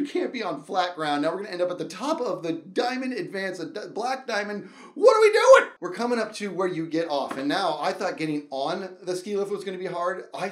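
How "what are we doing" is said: loud, high panicked shout; loud high cry of panic. Retold or imitated. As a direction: yell it in alarm.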